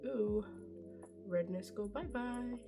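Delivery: ordinary talk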